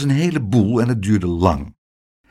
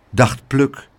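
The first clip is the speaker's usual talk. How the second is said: ordinary delivery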